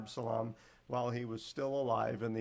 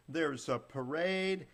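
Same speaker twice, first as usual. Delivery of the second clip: usual speaking voice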